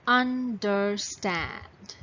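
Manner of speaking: "understand" very slowly, in three beats; broken into syllables. Shown as a demonstration. Teacher gives the next word to count, "understand" split into syllables. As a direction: stretch out each syllable of "understand". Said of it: "So how many syllables in it?".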